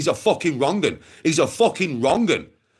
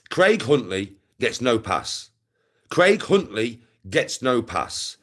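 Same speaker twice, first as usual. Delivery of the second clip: slow, deliberate delivery, word by word; measured, hard-stressed pronouncement; emphatic condemnation; 2 semitones lower, slower than usual. Real, not acted. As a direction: lay it down slowly as a verdict. Condemning a man, repeated for emphasis.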